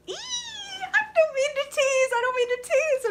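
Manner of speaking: loud, high squeal; giddy excitement. Real, not acted. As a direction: squeal it out, bursting with news. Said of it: "I'm excited and it's like".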